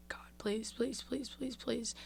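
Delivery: soft whispered begging; whispered pleading prayer. Retold or imitated. Praying silently for friends.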